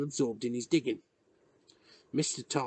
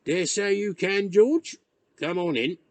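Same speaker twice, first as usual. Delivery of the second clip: put-on louder gruff old countryman; gruff old man; 6 semitones higher, 7 dB louder than usual. A narrator's reading. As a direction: grudgingly let the boy in. Tom voiced, louder.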